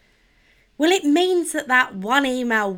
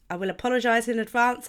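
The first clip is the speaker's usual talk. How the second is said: ordinary delivery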